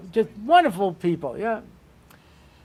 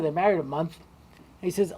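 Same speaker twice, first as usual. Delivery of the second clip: normal talking voice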